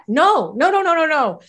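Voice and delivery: upset. Retold or imitated. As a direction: act out rapid, agitated refusals. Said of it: "So my mother gets upset".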